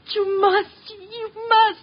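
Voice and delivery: desperate plea. Acted. Client begs the detective to take her case, desperate. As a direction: insist desperately, voice rising.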